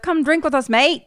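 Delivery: high-pitched mock British invitation; British bloke. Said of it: "there's a couple British men".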